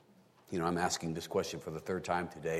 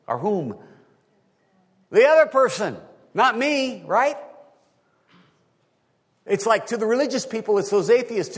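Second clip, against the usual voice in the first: high, loud preacher's voice; full-force preaching; 13 semitones higher, 9 dB louder than usual. Real, not acted. Preacher showing the congregation everyone blames the other side, impassioned preaching. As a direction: drive each example home, high and loud.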